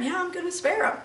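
quiet repeat of God's words. Retold or imitated. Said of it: "And God said".

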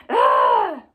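short loud frustrated yell; demo yell. Shown as a demonstration. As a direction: let out one sharp angry yell. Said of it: "Do you ever yell?".

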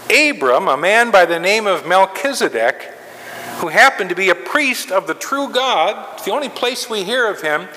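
normal talking voice

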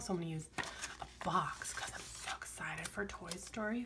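soft excited whisper. Real, not acted. Planner vlogger telling viewers about Toy Story, whispering. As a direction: whisper it like a little secret. Whispered aside while writing.

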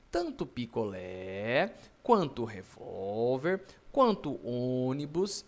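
slow, stretched-out words; drawn-out listing. Real, not acted. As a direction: draw out each item of the list. Teacher listing accented words slowly.

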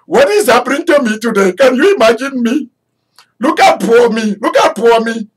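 put-on high, fast, loud disbelief in an Indian accent; Mordecai amazed. Mordecai, newly promoted, exclaims to everyone in an Indian accent. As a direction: gush like a man who can't believe his luck. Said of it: "you can hear Mordecai just go".

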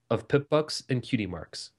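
usual speaking voice

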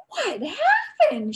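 high, slow, loud alarmed cry; mother seeing her hurt son, alarmed. A narrator's reading. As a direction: cry out in shock at the blood. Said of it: "she cried".

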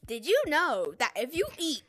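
normal talking voice

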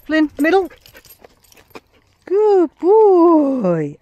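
high, loud, sing-song praise; excited dog praise. Real, not acted. Owner praising and calling the dogs, loud sing-song. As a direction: gush over the dogs at full volume.

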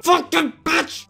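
high, loud, slow curse; a sudden loud, drawn-out angry curse; angry outburst. Real, not acted. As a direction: spit it out in fury. Angry rant about failing.

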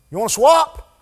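loud, high taunting question; mocking offer. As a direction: toss it out like a cheeky dare. Stranger offers to swap pastors.

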